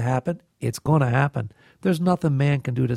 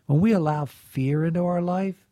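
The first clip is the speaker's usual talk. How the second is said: usual speaking voice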